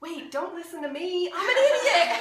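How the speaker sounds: high, loud backpedalling, ending near a laugh; hammed-up panic, leaping up in pitch; mock panic. Retells panicked reaction to friend quitting.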